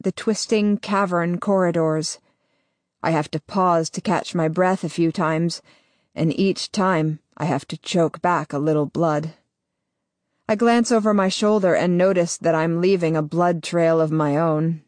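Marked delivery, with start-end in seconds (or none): none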